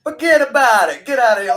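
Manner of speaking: loud, high mock New York dismissal; New York accent. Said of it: "they had the accent and everything".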